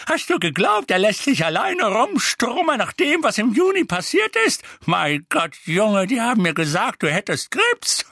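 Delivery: high, loud, exasperated scolding; worked-up old-woman reproach; impatient. A narrator's reading. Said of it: "sagte Misses Figg ungeduldig".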